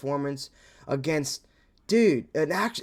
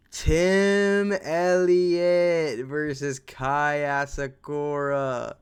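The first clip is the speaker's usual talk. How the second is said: announcer call; 4 dB louder, much slower than usual. Pretend. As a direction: stretch every name like a ring announcer. Drawn-out fight announcer call.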